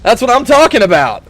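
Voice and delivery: loud, high gloat; gloating cheer. Real, not acted. Gamer gloating at the enemy he just destroyed. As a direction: crow over the downed enemy.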